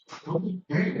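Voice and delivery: soft shocked echo of Coca cocaine; mock disbelief. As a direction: repeat Coca cocaine, taken aback. Shocked reply to offer.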